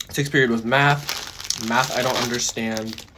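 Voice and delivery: talking while crunching food; chewing between words; crunching chips. Real, not acted. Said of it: "I'm sorry if I'm crunching on it".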